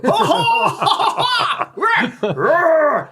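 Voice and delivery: loud high imitated cackle into a roar; mad heckler guffaw. Mad royal uncle heckles from the box, guffawing and roaring. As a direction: bellow out a mock mad laugh, then roar. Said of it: "you'll hear him going".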